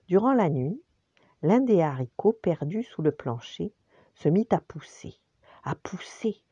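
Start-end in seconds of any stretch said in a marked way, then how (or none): 5.6-6.4 whispered echo. A narrator's reading. Repeated phrase whispered.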